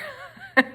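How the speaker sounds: brief high chuckle; short laugh. Real, not acted. Speaker joking to viewers about a crazy month, short high laugh. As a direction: let out a quick, amused laugh.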